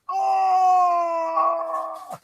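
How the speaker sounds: a high, yelping cry of pain after a kick to the groin. Retold or imitated. Farmer kicked in the bollocks cries out in a high pained yell. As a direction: let out a sudden high howl of pain.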